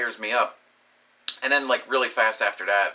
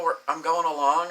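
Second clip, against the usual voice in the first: ordinary talk, nothing marked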